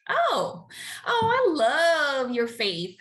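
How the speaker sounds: high, slow, gushing praise; gushing pastor. Retold or imitated. Pastor greeting the new choir member, gushing high and slow. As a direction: gush over her faith, high and slow. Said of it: "He goes".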